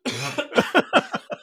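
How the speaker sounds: high, helpless laughing fit; real burst of laughter. Real, not acted. Listener reacts to the putting story, bursting out laughing. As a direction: crack up at the punchline. Cracking up at the punchline.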